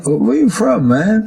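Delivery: high, loud astonished question; mock disbelief at a stranger; amazed friend.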